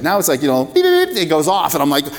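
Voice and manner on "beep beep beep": high, quick mimicked beeping; mock phone beeps. Preacher to congregation, imitating his phone going off in high mock beeps. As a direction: chirp it out like a buzzing phone.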